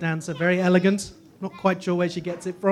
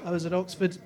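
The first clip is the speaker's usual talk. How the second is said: usual speaking voice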